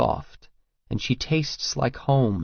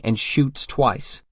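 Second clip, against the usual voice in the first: ordinary delivery, nothing marked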